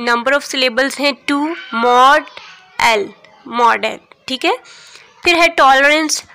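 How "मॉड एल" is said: मॉड and एल said apart as two separate beats; syllable by syllable. Shown as a demonstration. Teacher breaking a word into syllables for students, deliberate split. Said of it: "इसके syllable division में आप देख लें".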